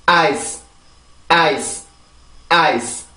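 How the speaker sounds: 아이스 as English "ice", stressed vowel, short soft S; soft final S. Shown as a demonstration. Teacher modelling for repetition, 아이스 with soft final S. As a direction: end 아이스 on a light S with no added 으 vowel. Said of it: "순수하게 이 자음만 만들어주고".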